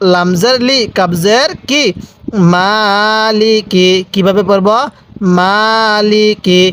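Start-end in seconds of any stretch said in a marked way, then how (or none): 2.5-3.9 slow "মালিকি" with the first vowel drawn out; one-count madd. Shown as a demonstration. Teacher shows students how to read it, slow "মালিকি" with long "মা". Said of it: "মায়ের মধ্যে এক আলিফ টান দিব". 5.4-6.6 slow "মালিকি" with the first vowel drawn out; one-count madd. Shown as a demonstration. Teacher shows students how to read it, slow "মালিকি" with long "মা". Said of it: "মায়ের মধ্যে এক আলিফ টান দিব".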